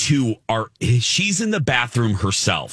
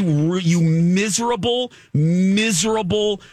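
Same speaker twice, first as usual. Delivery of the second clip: high, slow, stretched insults; hammed-up tirade; 9 semitones higher, slower than usual. Daughter ranting at the rude woman, slow and high. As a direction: lean on each miserable. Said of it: "I was like".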